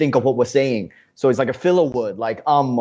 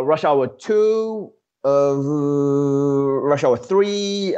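drawn out; much slower than usual. Acted. Listing Rush Hour sequels.

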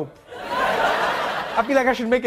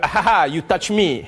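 mock French-accented chuckling banter; lightly lowered French-accented voice with a fake chuckle; 4 semitones lower than usual. French friend teasing another in conversation, French-accented mock chuckle. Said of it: "French people just hanging out with each other".

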